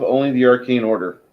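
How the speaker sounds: usual speaking voice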